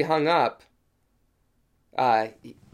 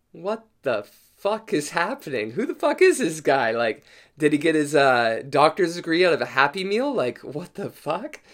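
can't believe this guy, voice pitched up; incredulous inner rant; 3 semitones higher than usual. Retold or imitated. Thinking to himself, baffled by dentist.

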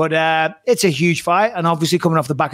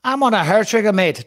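mock Northern Irish accent, higher pitched; Conor McGregor; 5 semitones higher than usual. Host plays McGregor warning a rival, mock Northern Irish accent. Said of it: "it's the only Irish accent that I can do".

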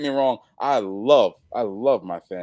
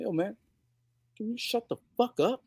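high but softer biting threat; hushed snap; 11 semitones higher, 6 dB quieter than usual. Retold or imitated. Wrestler hushes the fan across the table, hushed snap. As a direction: lean over the table and bite it out. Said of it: "I'm like".

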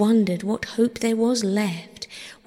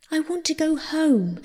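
high, plaintive wail; sobbing plea; 9 semitones higher than usual. A narrator's reading. Captive Persephone to Pluto, acted tearful plea. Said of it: "sobbed Persephone".

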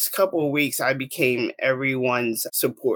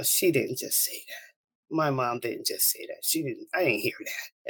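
hushed, disbelieving self-talk; stunned denial; 3 semitones lower, 7 dB quieter than usual. Retold or imitated. Daughter refusing her mother's news, hushed denial. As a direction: drop soft and low, refusing the news.

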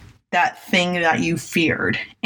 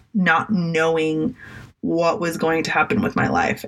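usual speaking voice

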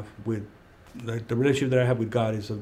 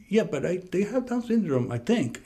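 higher-pitched imitation of an Indian accent; 8 semitones higher than usual. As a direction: imitate the Indian doctor, voice lifted.

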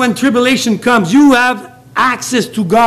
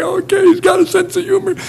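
imitating crying; 11 semitones higher than usual. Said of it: "I'm crying".

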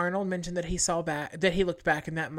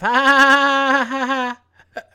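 loud, high, fake ha-ha laugh; stage a polite fake laugh; courtesy laugh; 6 semitones higher, 11 dB louder than usual. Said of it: "let me give you a courtesy laugh".

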